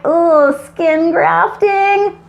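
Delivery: high, drawn-out playful "eww" voice; mock disgust. Reviewer recalling the gross tool while asking for likes, mock-disgusted. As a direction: draw it out slowly, hammed-up squeamishness.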